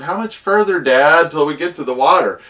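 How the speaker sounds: put-on high, pushy child voice; a kid nagging from the back seat. Kid nagging Dad about the distance, high and pushy.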